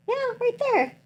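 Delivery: imitating reassuring doctor. Doctor showing patient the baby, high sweet imitation. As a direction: lilt it high like pointing something out.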